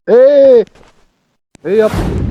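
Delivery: high, loud startled "what?"; shocked outburst. Real, not acted. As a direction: blurt it out in disbelief. Shocked at game event.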